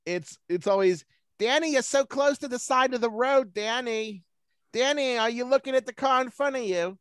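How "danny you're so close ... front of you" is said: higher, nagging mom impression; mocking my mom's backseat nagging, high and fussy. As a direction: tease her like a fretting mother in the passenger seat. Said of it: "I make fun of her in that voice".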